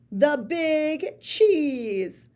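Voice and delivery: the Big Cheese stretched out slowly, each word weighty; slow grand announcement. Acted. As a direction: draw it out like a title. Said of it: "say it with me".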